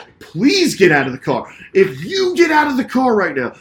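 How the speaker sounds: high, loud mock challenge; bolstered bravado. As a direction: dare a smaller rival, re-enacting it. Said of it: "I immediately got bolstered".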